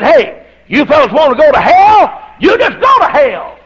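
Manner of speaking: bowed-up anger. Retold or imitated. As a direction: bark it at the men in the corner. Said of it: "boy, I bowed up".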